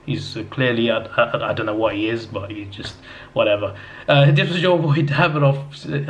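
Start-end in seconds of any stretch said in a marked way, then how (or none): 4.1-6.1 slow, drawn-out unhinged character voice; madman voice. Retold or imitated. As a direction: ham it up like a wild madman. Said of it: "I sound like a madman here".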